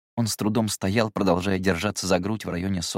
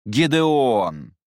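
loud reproachful call; 4 semitones higher, 5 dB louder than usual. Acted. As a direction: scold him by name. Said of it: "с упрёком воскликнул Люмьер".

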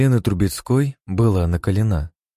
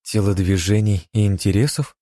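usual speaking voice

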